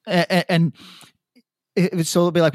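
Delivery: ordinary delivery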